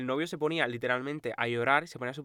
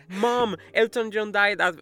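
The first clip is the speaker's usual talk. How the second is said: slow, higher, louder mock kid voice; quoting a kid; 7 semitones higher, 7 dB louder, much slower than usual. Quoting a kid telling mom someone famous died, slow and higher. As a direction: drag out the kid's news in a put-on voice.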